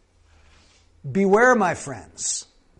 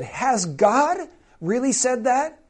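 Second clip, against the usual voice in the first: higher, rising challenge; doubting question; 6 semitones higher, slower than usual. Acted. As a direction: question it with mock doubt, pitch climbing to the end. Voicing Satan's doubting question to Eve.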